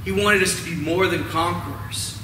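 ordinary talk

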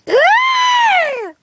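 sudden high shriek; crazed shriek. Acted. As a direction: let out a wild shriek. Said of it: "I can't control my craziness".

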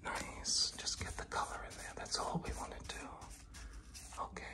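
hushed whisper while working; soft, whispered instructions. Real, not acted. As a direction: talk yourself through the job in a whisper. Whispers along while painting.

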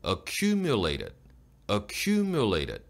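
"Accumulated" with a long, stressed "cu"; lengthened stressed syllable. Shown as a demonstration. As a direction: slowly push out "cu" in "Accumulated", lips forward. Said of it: "조금 더 길게 내밀어 주세요".

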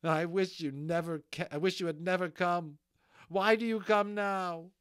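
high, loud, hurt outburst; anguished reproach. Acted. Molly, near tears, reproaches the unicorn, anguished. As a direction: push the reproach up into a loud plea.

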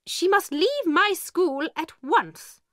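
high, slow, furious order; angry. A narrator's reading. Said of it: "Miss Minchin said angrily".

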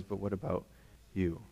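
pointed quiet question. Acted. Jesus turning the question on his hearers, hushed. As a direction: lean in and ask it softly, straight at them. Jesus turns spotlight on listeners.

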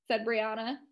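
ordinary delivery, nothing marked

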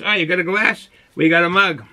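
normal talking voice

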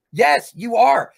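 emphatic loud put-down. Real, not acted. Mocking a rival streamer.

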